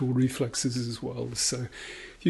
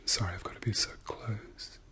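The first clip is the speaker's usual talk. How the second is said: whispering; 3 semitones lower, 7 dB quieter than usual. Acted. ASMR doctor exam roleplay.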